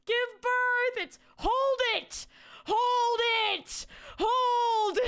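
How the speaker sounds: high, loud comic yell; hammed-up outraged yell; mock shout. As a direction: shout it like a frantic coach. Mock yelling at baby-holding mom.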